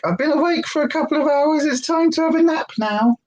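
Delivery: high, sing-song puppy voice; voicing the sleepy puppy. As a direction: speak for the dog in a sweet high voice. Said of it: "he's like".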